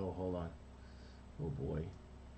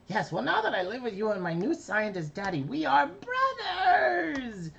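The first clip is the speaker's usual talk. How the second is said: high blubbering little brother voice; Carbonate voice; 14 semitones higher than usual. Acted. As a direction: stretch it out like a weepy happy kid. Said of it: "Carbonate's emotional line".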